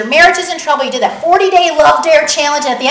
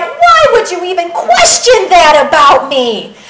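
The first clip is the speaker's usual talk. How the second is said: raised, loud incredulous question; indignant disbelief; 4 semitones higher, 6 dB louder than usual. Acted. Jesus to the doubting father, indignant and incredulous. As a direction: protest as if insulted by the doubt. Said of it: "that's what Jesus is like".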